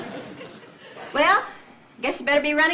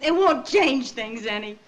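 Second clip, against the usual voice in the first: usual speaking voice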